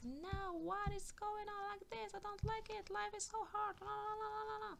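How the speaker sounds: high, monotone mock complaint; mock whining. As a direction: whine it on one high note, trailing into la-la. Mimics people complaining about life.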